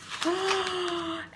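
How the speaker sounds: high, quick gasp; sharp storyteller's intake of breath; excited gasp. Acted. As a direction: gasp in pretend delight. Storyteller reacts.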